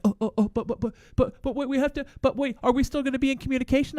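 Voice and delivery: nervous, hurried pleading; a lightly voiced panicked neighbor; flustered backpedaling neighbor.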